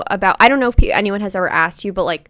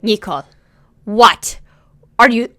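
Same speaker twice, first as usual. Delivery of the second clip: mock exasperation, louder and halting; acted-out annoyed sputter; annoyed mom; 6 dB louder than usual. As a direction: imitate a mom at a loss, in disbelief. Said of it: "And she got so annoyed".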